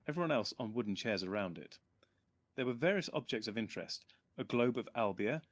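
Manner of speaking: usual speaking voice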